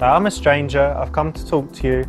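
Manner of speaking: normal talking voice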